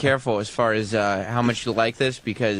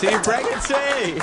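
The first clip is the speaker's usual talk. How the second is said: high, drawn-out mock cheer; mock exclamation; 11 semitones higher, slower than usual. Co-host echoing the comic's punchline, mock exclamation.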